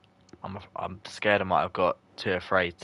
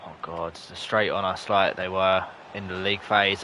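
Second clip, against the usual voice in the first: ordinary delivery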